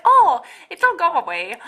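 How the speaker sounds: grandma brushing it off. Retold or imitated. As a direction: imitate grandma waving it away lightly. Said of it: "my grandma's like".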